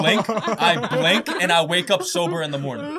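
loud laughing punchline. Real, not acted. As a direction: laugh through the reveal.

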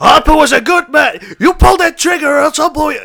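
high, loud, hammed-up yelling; acted outraged shouting; furious protest. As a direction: bellow at someone holding a gun. Detective rages as gun is drawn.

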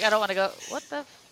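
ordinary talk

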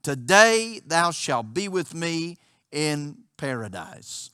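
slow, solemn scripture reading. Acted. Preacher reading scripture aloud to the congregation, solemn. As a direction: read the verse with reverence, slowly.

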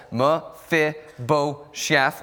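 Mephibosheth said slowly, syllable by syllable, stress on second syllable "phib"; teaching the congregation to say Mephibosheth, slow and careful; slow name demo. Shown as a demonstration. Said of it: "The stress is on the second syllable".

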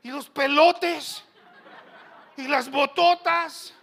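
loud, high, stretched-out items; exaggerated bigness. Preacher lampooning big Sonoran men, loud and exaggerated. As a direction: belt out each item, hugely drawn out. Exaggerates the men's huge gear.

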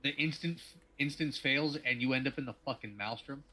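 ordinary talk, nothing marked

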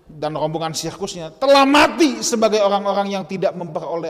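loud, ringing sermon delivery; preaching at full force. Acted. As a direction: proclaim it to the whole hall. Preacher reading and expounding loudly. (berkhotbah dengan suara lantang)

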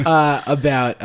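normal talking voice

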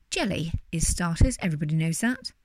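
ordinary delivery

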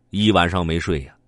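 ordinary delivery, nothing marked

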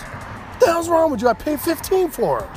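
high, loud, incredulous yell; retold outrage. Yelling at his buddy over the cheap sale, high and furious. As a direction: blow up at him in disbelief.

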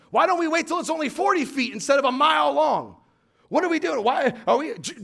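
lightly voiced anxious Israelites; nervous grumbling people by the river; doubting crowd. Preacher voicing doubting Israelites.

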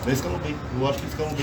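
softly spoken, low-key answer; too quiet. Real, not acted. Answering the co-host about the line-up, quietly. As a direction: say it low and under your level. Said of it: "um pouquinho mais alto".

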